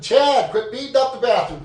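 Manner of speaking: laut und höher hinüberrufen; calling out. Retold or imitated. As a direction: ruf ihn ungeduldig heraus. Yelling for friend through bathroom door.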